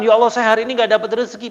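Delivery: fast, high, loud lament; lamenting brother. Retold or imitated. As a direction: blurt out the complaint in dismay. Brother laments his lost sales. (keluhan cepat, tinggi dan keras)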